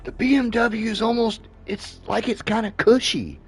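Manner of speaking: high-pitched happy praise; excited. Real, not acted. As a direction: gush about how cushy it feels.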